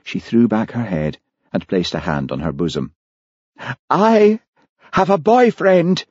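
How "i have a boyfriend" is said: high-pitched, drawn-out melodrama; theatrical announcement. A narrator's reading. Heather declares herself to Hamish, high and theatrical. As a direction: proclaim it high and slow, like a stage heroine.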